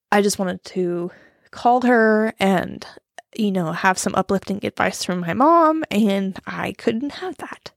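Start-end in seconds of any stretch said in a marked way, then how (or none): none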